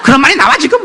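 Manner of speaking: high, loud mock snap of a wife; mother snapping. Wife snaps at husband during school planning, high mocking shout. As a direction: shriek it like an annoyed wife cutting him off.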